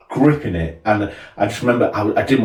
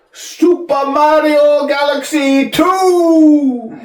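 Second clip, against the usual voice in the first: high, loud, drawn-out whoop; stretched, shouted game-title cheer; mock grand announcement; 6 dB louder, much slower than usual. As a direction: announce the winner like a fanfare. Grand reveal of number one.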